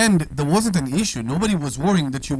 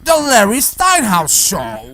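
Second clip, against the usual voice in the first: slow, louder show announcement; announcer voice; 3 semitones higher, 6 dB louder, slower than usual. Acted. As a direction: boom out the title like an announcer.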